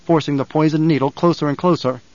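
ordinary delivery

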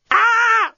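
shrill high scream; a robot screaming in alarm. A narrator's reading. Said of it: "screamed the deskbot".

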